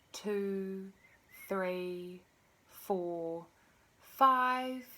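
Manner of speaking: slow, drawn-out counting with long pauses; guided breathing count. Real, not acted. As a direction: pace each number to a long, steady breath.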